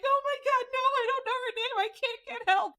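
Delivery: very high, panicky squeal of words; mock panic. Sleeper who can't get help, high mock despair. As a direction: wail it high, hammed-up.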